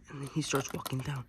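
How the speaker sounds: ordinary talk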